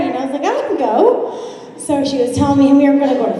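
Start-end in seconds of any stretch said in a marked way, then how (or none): 0.4-1.3 higher, quick eagerness; eager jump-in. Retold or imitated. Young bartender eagerly inviting herself along, higher. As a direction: grab the chance.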